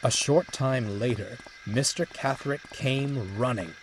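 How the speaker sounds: ordinary delivery, nothing marked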